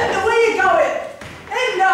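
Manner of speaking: high, loud shout after her; calling after someone. Acted. Boy calling after Linda as she goes, urgent. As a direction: call out to stop her leaving.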